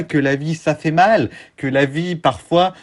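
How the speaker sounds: ordinary delivery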